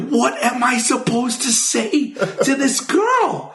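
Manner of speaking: mortified. Retold or imitated. Panicked at taking the call.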